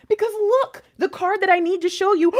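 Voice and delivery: high, loud, breaking into amazement; freaking out. Real, not acted. Reader showing the card she needed, freaking out. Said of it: "why I'm freaking out".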